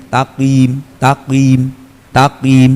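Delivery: "taqim" with a heavy, full qaf; thick qaf. Shown as a demonstration. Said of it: "Hurufnya tebal begini". ("taqim" dengan qaf tebal)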